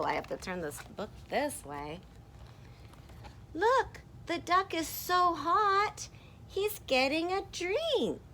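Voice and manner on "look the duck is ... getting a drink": high, slow, louder character voice; excited pointing out. Acted. First character showing the hot duck drinking, high excited voice. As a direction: draw it out high and eager.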